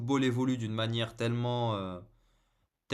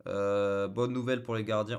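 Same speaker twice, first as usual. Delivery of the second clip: ordinary delivery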